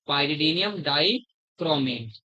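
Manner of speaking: "pyridinium dichromate" drawn out very slowly; slow emphatic naming. Shown as a demonstration. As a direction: stretch out "pyridinium dichromate" to fix it in memory. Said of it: "teacher stresses the reagent name". ("pyridinium dichromate" धीरे और ज़ोर देकर)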